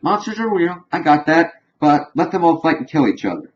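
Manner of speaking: lightly voiced scornful queen; mock Cersei. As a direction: imitate a dismissive queen lightly. Voicing Cersei in the argument.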